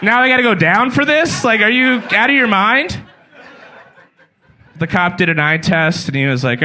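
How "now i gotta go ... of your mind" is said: mock outrage.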